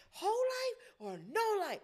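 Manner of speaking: put-on high, slow sassy teen; sassy teenage girl. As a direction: drawl it like a snarky teen. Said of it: "she'll be like".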